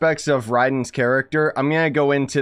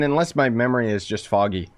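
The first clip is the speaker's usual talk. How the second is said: normal talking voice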